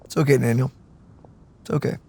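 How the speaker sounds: low, soft reassurance; soothing. Real, not acted. Streamer comforting Daniel aside, low soothing murmur. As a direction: gently hush and comfort, voice dropped.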